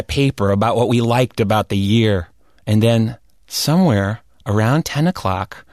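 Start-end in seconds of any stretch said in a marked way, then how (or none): none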